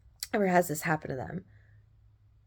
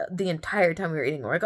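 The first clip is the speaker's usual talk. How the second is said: ordinary delivery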